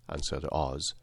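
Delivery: ordinary delivery, nothing marked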